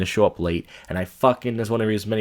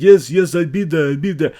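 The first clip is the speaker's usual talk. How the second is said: loud, high mocking Russian accent; imitated Russian accent; 7 semitones higher, 7 dB louder than usual. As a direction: mimic empty promises in a Russian accent. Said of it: "Sounds like a Russian guy".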